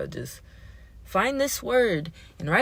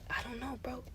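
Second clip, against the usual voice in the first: very soft, higher half-whisper; hushed reply; 5 semitones higher, 19 dB quieter than usual. Retold or imitated. Narrator answering a groupmate in quiet class, hushed. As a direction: answer under the breath.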